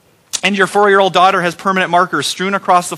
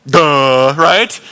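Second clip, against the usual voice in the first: loud, dopey duh; teasing the boys' dumb answer; mock duh; 4 semitones lower, 3 dB louder than usual. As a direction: play the dimwit.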